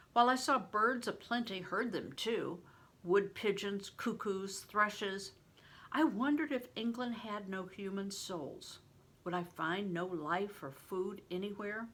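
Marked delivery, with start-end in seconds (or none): none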